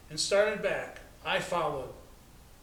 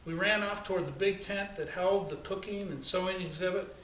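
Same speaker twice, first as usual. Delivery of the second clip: ordinary delivery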